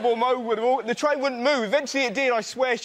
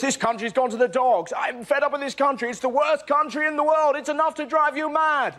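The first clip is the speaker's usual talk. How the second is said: mock moaning rant; 6 semitones higher than usual. Comic mocking people complaining about the country, higher whinging rant. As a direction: whine like a fed-up Brit. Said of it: "All you hear is".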